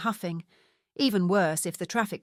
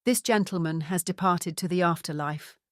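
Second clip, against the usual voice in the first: ordinary delivery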